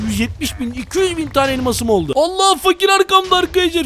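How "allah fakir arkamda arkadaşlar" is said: startled. Real, not acted. Startled, poor guy behind him.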